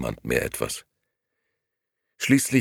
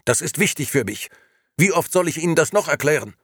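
loud, high, irritated demand; heated and loud, speeding up at the end; exasperated shouting; 12 semitones higher, 5 dB louder than usual. A narrator's reading. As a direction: insist at the end of your patience. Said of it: "brüllte Gigi aufs Äußerste gereizt".

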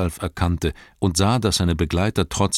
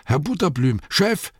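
surprised call; 9 semitones higher than usual. Acted. Servant Nob calling his master at the door, excited shout. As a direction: yelp out for the boss. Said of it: "rief er".

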